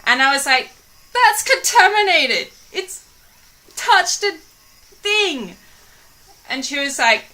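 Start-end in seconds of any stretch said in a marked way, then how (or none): 1.1-5.6 high, loud alarmed objection; mock alarmed protest. Telling the deli worker it's contaminated, high and alarmed.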